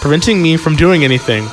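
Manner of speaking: ordinary talk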